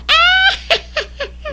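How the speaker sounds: high shrieking witch cackle; witch laugh. Pretend. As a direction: cackle like a wicked old hag. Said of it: "then I do my witch laugh".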